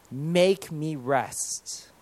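very slow, soft plea; hushed prayer. Acted. Preacher coming before Jesus asking for rest, slow soft prayer. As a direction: pray it softly, stretching each word.

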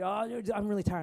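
a hint of hammed-up weariness; tired disciples. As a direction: play a sleepy slacker begging off. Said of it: "And they go and say".

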